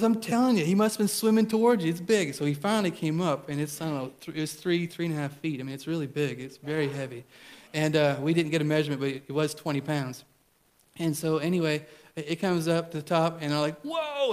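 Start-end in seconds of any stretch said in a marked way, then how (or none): 0.0-2.3 mock the son pleading for the net; insistent son. Son insisting about fish. 13.8-14.3 sudden high whoa of amazement; surprised whoa. Retold or imitated. Huge fish surfaces.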